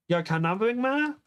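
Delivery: high-pitched, incredulous question; shocked question. Acted. Heroine thinks Kim is kidnapping her, high shocked question. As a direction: squeak it out in disbelief.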